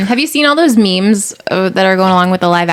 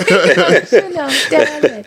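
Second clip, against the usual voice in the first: slow meme voice laughing; 5 semitones higher, slower than usual. Acted. Meme catchphrase echoed.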